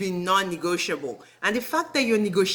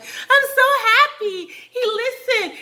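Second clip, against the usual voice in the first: much higher, slow, teary-laughing gush; hammed-up happy tears, pitched way up; mocking a gushing girlfriend; 14 semitones higher, 5 dB louder than usual. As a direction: imitate her overjoyed sob-laugh. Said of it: "she comes like".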